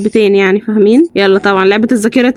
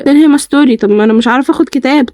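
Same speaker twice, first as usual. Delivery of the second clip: ordinary delivery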